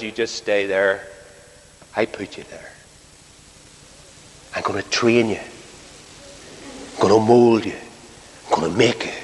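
low, soft, unhurried reply; God answering calmly. Retold or imitated. God replying to a frustrated believer, low quiet assurance. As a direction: answer quietly and firmly, pitched well down.

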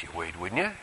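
ordinary delivery

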